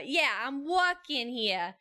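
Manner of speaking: drawn-out Brooklyn "I'm walkin' here"; New York accent. Shown as a demonstration. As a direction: stretch it out like a Brooklyn New Yorker. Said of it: "start talking about New York".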